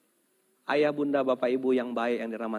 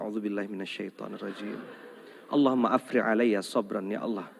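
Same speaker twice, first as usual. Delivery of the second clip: weary husband's prayer; 5 semitones lower than usual. Retold or imitated. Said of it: "Kata suaminya".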